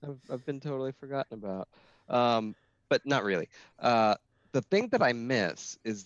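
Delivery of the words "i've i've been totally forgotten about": soft, slightly higher mock self-pity; mock sad. Former host voicing feeling forgotten by podcasters, mock sad. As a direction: play at feeling left out, mildly sad. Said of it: "sad sometimes".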